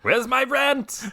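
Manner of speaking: shrill demanding landlord yelling for rent; cold greedy landlord. Acted. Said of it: "there's a Russian Star Child that's like".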